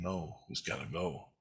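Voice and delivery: very soft, held-back refusal; quiet inner voice. Retold or imitated. Inner light answering the gut, quiet and soft. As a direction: say it low in volume, like an inner voice.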